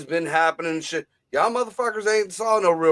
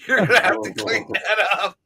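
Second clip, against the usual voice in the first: mock message to Victoria; 4 semitones higher than usual. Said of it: "We gotta tell Victoria".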